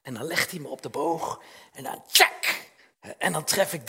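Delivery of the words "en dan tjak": arrow sound. Retold or imitated. Arrow released from the bow.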